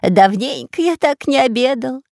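high, mildly wailing satisfaction; gushing glutton. A narrator's reading. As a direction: lament happily in a high voice. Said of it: "причитал Пулькин".

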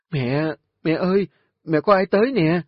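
daughter shouting across the yard, high and loud; calling out loud. Acted. Daughter calling loudly to mother about visitors. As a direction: call out to mother from outside. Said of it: "tiếng con Nga kêu lớn".

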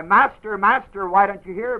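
high, loud pleading; disciples crying. Retold or imitated. Disciples plead with an unhearing master, high and loud. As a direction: plead to be heard. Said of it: "Disciples will cry".

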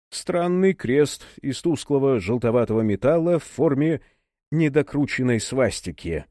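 ordinary delivery

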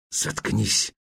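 hissed, unvoiced shut up. A narrator's reading. Frightened Herbert hisses at Ruth to be quiet. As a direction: hiss it so nobody hears. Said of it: "прошипел он".

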